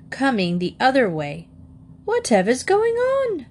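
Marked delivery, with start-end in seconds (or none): 2.0-3.5 very high-pitched, bewildered query; startled story-character voice; astonished question. A narrator's reading. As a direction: exclaim it high, demanding to know what's happening. Startled housekeeper meets moving parcels.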